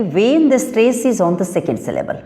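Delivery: slow, careful dictation of the rule; reading the rule aloud. Shown as a demonstration. Teacher dictating the rule to students, slowly and deliberately. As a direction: spell out the rule word by word for the class.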